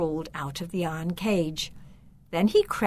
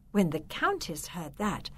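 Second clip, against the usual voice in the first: usual speaking voice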